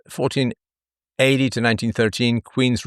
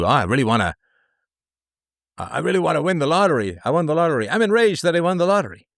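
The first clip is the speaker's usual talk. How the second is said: high, fast, mocking whine of a spoiled complainer; mock whiny complainer; 5 semitones higher, faster than usual. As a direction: sneer as you play the ungrateful lottery winner. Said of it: "it's like the people who".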